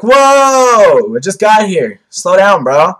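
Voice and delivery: long loud high whoa, easing down; Madara. Retold or imitated. Vlogger parodying Madara telling Kabuto to wait, mock-startled. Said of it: "And Madara's like".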